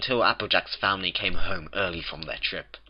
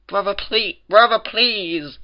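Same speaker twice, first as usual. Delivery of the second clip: higher, slow pleading whimper; 13 semitones higher, 7 dB louder, slower than usual. A narrator's reading. Applejack begs her brother, whimpering. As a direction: beg through tears. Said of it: "Applejack whimpered".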